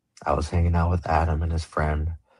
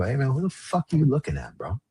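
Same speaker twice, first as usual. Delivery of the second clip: higher-pitched challenge, not shouted; retold confrontation; 7 semitones higher than usual. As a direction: call him out, pitch raised, volume held. Confronting a staring man on bus.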